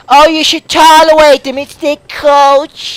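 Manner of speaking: hammed-up mock pleading, stretched at the end; mocking imitation. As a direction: mimic the tolerant crowd with scorn. Mocks what everyone says about dolphins.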